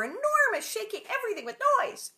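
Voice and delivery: thrilled narration of the arriving train; dramatic build. A narrator's reading. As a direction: swell it like the train rushing in.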